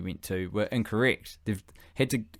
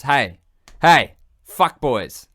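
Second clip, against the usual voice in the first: loud, slow hail to a group; calling out; 2 semitones higher, 8 dB louder, much slower than usual. Acted. Somali rallying the boys, calling out. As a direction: call them to attention, drawn out.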